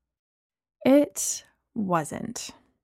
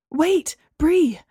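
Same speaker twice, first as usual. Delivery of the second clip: high-pitched, urgent call; crying out; 6 semitones higher, 3 dB louder than usual. A narrator's reading. James calling to Bree from the taxi, crying out. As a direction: call out to stop someone leaving. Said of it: "James cried".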